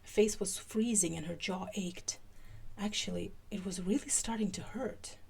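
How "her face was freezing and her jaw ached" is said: usual speaking voice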